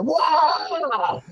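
high, hammed-up battle scream; recruit's mock war face scream, high. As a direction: let out a put-on bloody murder yell. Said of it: "screams bloody murder".

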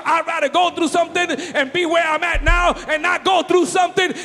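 loud, fervent preaching over music; full-force preaching. Real, not acted. Preacher shouting praise to the congregation, fervent.

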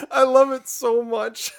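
ordinary talk, nothing marked

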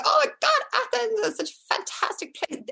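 very high-pitched mock delight; gushing mother. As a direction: imitate a mum enthusing about a holiday spot. Mother enthusing about the trip, acted.

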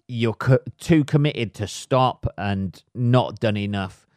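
usual speaking voice

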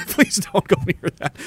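high plea through laughter; laughing plea. Retold or imitated. Veterans begging people to stay away, retold laughing and high. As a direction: repeat the warning while cracking up.

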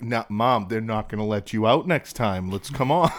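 hammed-up coaxing, laughing at the end; mock coaxing caretaker. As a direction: son coaxing his angry mother out of the restaurant, loud and mock-gentle. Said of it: "I'm like".

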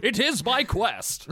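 high, slow mock-noble declaration; questing vagabond. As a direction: declare it like a hammed-up adventurer. Answers as the vagabond.

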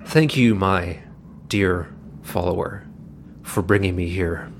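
lightly voiced low, deliberate visitor; gray cloaked man. Gray cloaked man thanking his follower, a lightly voiced low, deliberate role. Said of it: "the gray cloaked man looks at Gutterbird and, and says".

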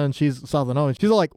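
usual speaking voice

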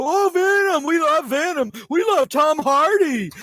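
high-pitched mock fan gushing; singsong, high mock adoration. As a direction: imitate swooning fans with scorn. Mocking fans who loved Venom.

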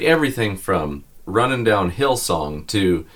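usual speaking voice

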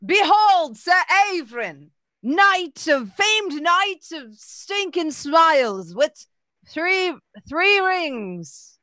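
loud, high, slow mock proclamation; loud announcer. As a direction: boom it like a pompous announcer. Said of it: "he says very loudly".

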